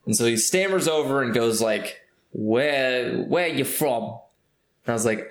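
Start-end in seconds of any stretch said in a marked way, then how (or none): 2.3-4.0 put-on drunk Brit, British accent drifting into Australian; a British accent that keeps sliding toward Australian; mock British accent. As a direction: play the drunk British guy asking where I'm from. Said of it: "your British accent is just Australian".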